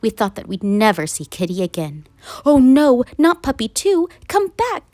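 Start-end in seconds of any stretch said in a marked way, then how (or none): 2.4-4.1 dismayed cry. Acted. Family dismayed as puppy runs. 4.3-4.9 high call to a running puppy; high call after the puppy. Acted. Calling after the runaway puppy.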